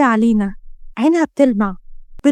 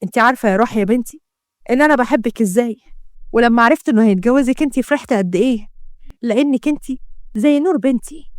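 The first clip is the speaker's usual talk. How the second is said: usual speaking voice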